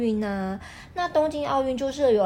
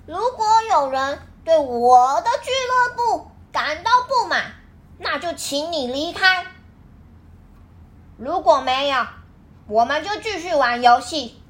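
haughty; 4 semitones higher, 4 dB louder than usual. A narrator's reading. Lucia threatens the club.

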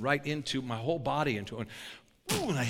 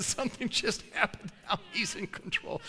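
laughing aside; 5 semitones higher, 4 dB quieter than usual. Real, not acted.

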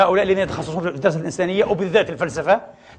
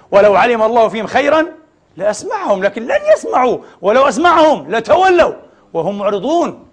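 raised, ringing Quran recitation; forceful recitation; 5 semitones higher, 6 dB louder than usual. Acted. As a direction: declaim the verses to the congregation. Preacher recites Quran verses forcefully. (تلاوة قوية مرتفعة الصوت)

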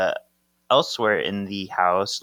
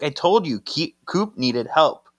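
retold defensive protest; 5 semitones higher than usual. Acted quarrel between Paige and Henry.

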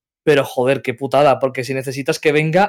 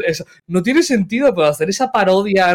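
ordinary delivery

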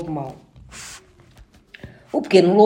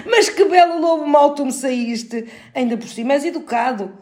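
mocking laughter; 7 semitones higher, 3 dB louder than usual. A narrator's reading. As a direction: jeer while laughing as the wolf. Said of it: "zombou o lobo mau entre gargalhadas".

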